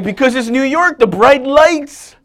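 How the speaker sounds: cab driver lifting high and loud, slowing on the bright lights; dreamy cab driver. Retold or imitated. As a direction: sell the bright lights like a dream he has had for years. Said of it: "He's like".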